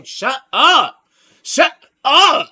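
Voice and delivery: loud, high yell, words stretched out; mock kid's yell. Kid snapping at people over his singing, mock yelling. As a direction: yell it high and slow, punching each word.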